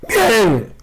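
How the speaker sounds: a cheer forced through a lost voice; hoarse cheer. Real, not acted. Cheering a great card pull despite losing his voice, high hoarse cheer. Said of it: "cuando estoy afónico".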